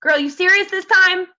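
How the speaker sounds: high, loud playful disbelief; sassy girlfriend talk. Retold or imitated. Coach quoting her reply to a poll, loud sassy teasing. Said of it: "like, talk like that".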